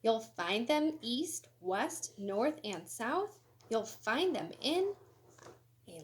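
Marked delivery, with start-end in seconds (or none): none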